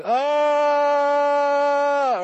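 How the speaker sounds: long, high, held yell; one drawn-out wail, loud and steady; retold scream. Telling how he fell to the ground under God's power, retold scream. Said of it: "I was screaming".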